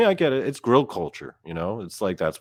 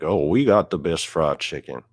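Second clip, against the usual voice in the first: exaggerated Texas twang; caricatured Texan brag; mock Texan accent; 2 semitones lower, 4 dB louder than usual. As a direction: boast in a put-on Texan accent. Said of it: "From, like, Texas".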